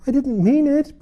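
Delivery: high, loud plea; pleading. A narrator's reading. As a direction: beg for forgiveness. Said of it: "pleaded poor Alice".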